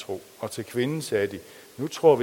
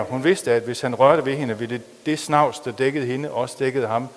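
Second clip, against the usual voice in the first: lively, louder novel reading; story reading; 6 dB louder than usual. A narrator's reading. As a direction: read the story aloud with drama. Reads a novel aloud in sermon. (dramatisk højtlæsning af en roman)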